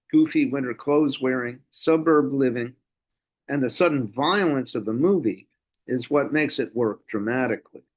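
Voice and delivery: ordinary talk, nothing marked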